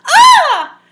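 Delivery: high, loud shriek; scream. Acted. Goldilocks wakes to three bears, loud high scream. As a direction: shriek in sudden fright. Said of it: "and she screamed".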